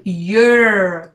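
"Your" with a strong, held R at the end; clear American R. Shown as a demonstration. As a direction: make the R in "Your" ring out. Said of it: "Con la R que se escucha".

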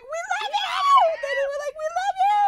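very high, loud squealed declaration; excited yelling. Retold or imitated. Fans and idols trading love while leaving, high mock screams. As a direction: squeal it back and forth, stretched out.

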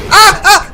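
high, loud yelps of fright; two sudden shrieks; startled scream. Real, not acted. Player startled by Roxy, screaming. Scared by enemy at fire.

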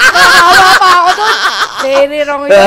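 loud, giggling words; laughing. Real, not acted. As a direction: talk through the laughter. Still laughing at the joke.

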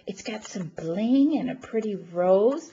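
ordinary talk

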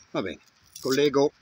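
ordinary delivery

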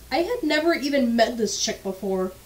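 usual speaking voice

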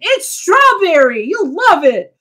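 put-on high, eager little boy pushing his lollipop; a child talking up his treat, pitched high; sweet kid voice. To the detective, a kid's high eager sales pitch.

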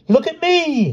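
put-on high-pitched, loud excited boy; thrilled shout in a child's high voice; boastful cry. A narrator's reading. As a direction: crow for attention, way up high. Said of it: "he cried".

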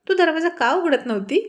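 high, put-on innocent question; mock-innocent counter-question; feigned innocence. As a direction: play dumb on purpose. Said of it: "मुद्दाम कळून न कळल्यासारखं करत".

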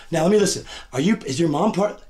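usual speaking voice

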